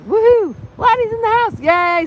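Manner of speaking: high, loud happy whoops; excited cheering. Real, not acted. Streamer cheering as a viewer joins the live. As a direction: cheer like a fan.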